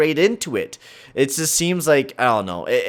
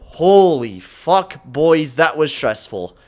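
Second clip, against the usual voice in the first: usual speaking voice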